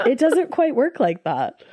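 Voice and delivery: slow, patient doctor explaining. Retold or imitated. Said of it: "He said".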